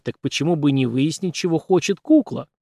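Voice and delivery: ordinary delivery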